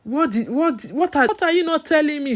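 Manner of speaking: high, loud, stammering plea; desperate demand, voice climbing high; distressed cry. A narrator's reading. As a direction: cry out in rising panic, tripping over words. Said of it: "she cried".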